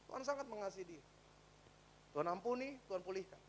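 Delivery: usual speaking voice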